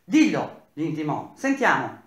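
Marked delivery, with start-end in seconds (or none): 0.0-0.6 loud curt command; angry engineer ordering the German to speak; brusque, irate. Acted. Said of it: "brusco, irato". 1.4-2.0 loud curt command; angry engineer ordering the German to speak; brusque, irate. Acted. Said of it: "brusco, irato".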